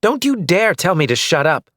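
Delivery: wife rising in anger, sharp and loud; angry retort. Acted. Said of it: "She stood up".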